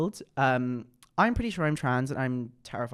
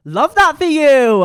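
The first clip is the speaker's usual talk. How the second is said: much higher, much louder, slow camp squeal; campy approval; 18 dB louder, slower than usual. Acted. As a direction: gush it like a camp bestie. Campy reaction to viewer story.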